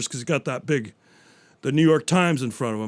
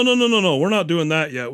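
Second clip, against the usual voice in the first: ordinary delivery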